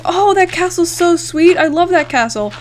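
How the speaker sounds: high, loud gushing over something cool. Retold or imitated. Retold gushing reaction to castle.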